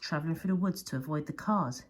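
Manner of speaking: ordinary delivery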